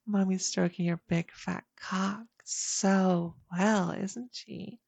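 usual speaking voice

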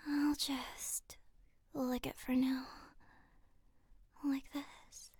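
intimate breathy whisper. Acted. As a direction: whisper it close to the ear.

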